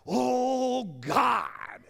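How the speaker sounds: overwrought prayer. Retold or imitated. Pastor mocking people's theatrical praying, loud and high. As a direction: ham up a wailing, showy prayer. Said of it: "some people say".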